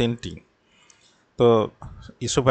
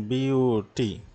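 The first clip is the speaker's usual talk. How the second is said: "Beauty" split slowly into বিউ and টি; slow syllable-by-syllable demo of "beauty"; broken into syllables; much slower than usual. Shown as a demonstration. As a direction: say beauty slowly, one piece at a time. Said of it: "মূলত ভেঙে ভেঙে হয়".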